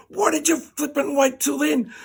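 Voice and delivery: high, loud, outraged scream; screamed yell. Retold or imitated. Patient to doctor after the tube is yanked, screaming. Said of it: "I screamed and yelled".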